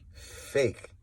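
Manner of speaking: breathy whisper, barely voiced; a scornful whisper; whispered fake. Real, not acted. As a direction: hiss the word under your breath. Calling out fakes, whispered.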